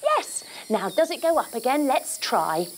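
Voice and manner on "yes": high, happy squeal; delighted yes. Acted. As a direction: squeal with joy. Zip works.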